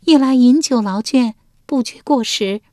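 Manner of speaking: high, coy court lady voice; lady Wenjiang. A narrator's reading. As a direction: answer sweetly as the young wife. (文姜娇声作答)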